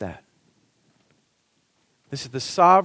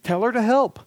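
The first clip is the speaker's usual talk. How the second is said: higher, louder indignant complaint; indignant Martha; 7 semitones higher, 5 dB louder than usual. Acted. Martha complains to Jesus, higher and louder in indignation. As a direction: whine it out, put upon and annoyed. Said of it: "Martha became indignant and said".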